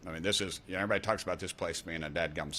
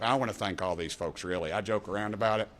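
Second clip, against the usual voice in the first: ordinary talk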